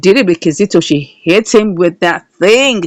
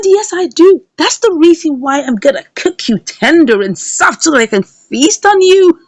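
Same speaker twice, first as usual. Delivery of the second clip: higher, louder sly menace, last words slowed; mock menacing threat; 8 semitones higher, 4 dB louder than usual. Mother threatening to feast on Flora, hammed-up menace. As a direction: savor the threat like a villain.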